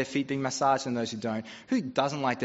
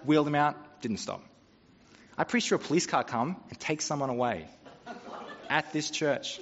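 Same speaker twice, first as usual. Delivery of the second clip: ordinary delivery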